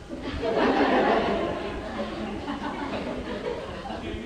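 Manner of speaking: a long, quiet, breathy chuckle at her own joke; soft laugh. Real, not acted. After the asylum story, a long quiet chuckle. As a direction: let a soft laugh roll out.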